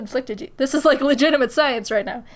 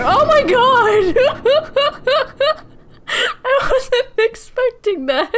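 startled laughing shriek; 12 semitones higher, 5 dB louder, much slower than usual. Real, not acted. Startled by a jump scare.

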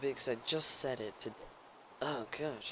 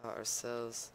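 normal talking voice

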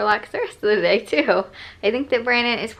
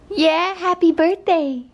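ordinary delivery

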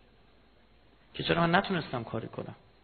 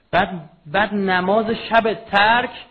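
loud, slow, raised-pitch outburst; impassioned preaching cry; 7 semitones higher, 13 dB louder, much slower than usual. Real, not acted. Preacher rebukes listeners about abandoned night prayer, impassioned cry. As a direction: cry it out high and drawn out.

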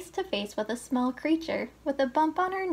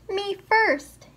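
high, loud "Me first!"; pushy piglet yelling to be first; piggy shout; cried out; 8 semitones higher, 5 dB louder than usual. A narrator's reading. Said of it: "he cried at story time".

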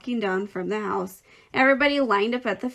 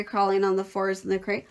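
usual speaking voice

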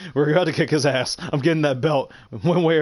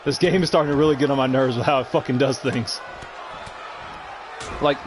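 ordinary talk, nothing marked

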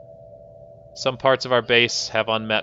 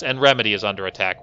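ordinary delivery

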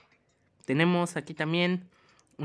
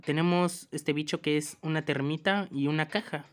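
ordinary delivery

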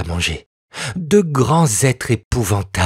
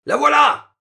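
much higher excited soldier's call; soldier's cry. A narrator's reading. Soldier calling to his captain on finding the girl, high and excited. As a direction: announce the find triumphantly. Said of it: "s'écria le plus laid des ogres".

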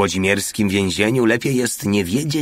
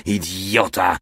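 plain reading voice

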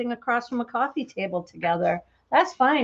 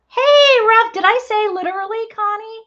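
calling out; 10 semitones higher, 9 dB louder than usual. Real, not acted. Calling out playfully to viewer.

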